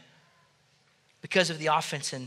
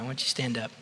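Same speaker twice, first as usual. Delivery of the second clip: normal talking voice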